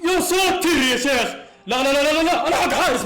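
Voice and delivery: high, loud alarmed cry of no no no; panicked shout. Real, not acted. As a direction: scream as the shot flies in. Panicked near-goal moment. (صرخة هلع عالية)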